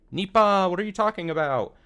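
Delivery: put-on high cutesy girl voice, opening loud; sudden sing-out catchphrase, then high innocent question; cutesy Rika. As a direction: imitate an innocent, adorable character. Rika snaps back to cute self.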